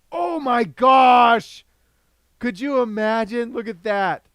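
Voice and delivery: loud, slow amazed cry; excited shout. Real, not acted. Lottery spin result reaction.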